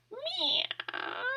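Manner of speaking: high, drawn-out cat meow. A narrator's reading. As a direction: mew like a cat. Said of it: "said Wilbur".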